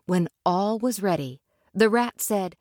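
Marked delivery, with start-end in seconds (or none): none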